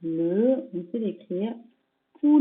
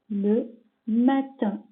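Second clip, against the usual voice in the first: slow dictation; much slower than usual. Shown as a demonstration. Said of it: "repeat of dictation, slow".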